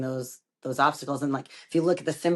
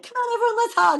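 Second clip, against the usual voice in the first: much higher and louder, bubbly overjoyed invitation; overjoyed hug call; 6 dB louder than usual. Retold or imitated. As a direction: play the blissed-out mom calling everyone in for a hug. Her calm post-yoga self voiced.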